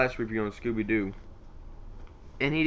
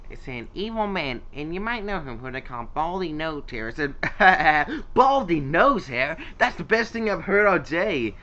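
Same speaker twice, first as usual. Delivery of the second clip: higher, animated mocking cartoon hero; quoting Sonic; 4 semitones higher, 5 dB louder than usual. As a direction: build from retelling into loud, laughing ridicule. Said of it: "it went something like this".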